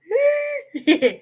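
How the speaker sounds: high squealing laugh; high giggle. Real, not acted. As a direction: let out a high-pitched giggle. Laughing after cheering.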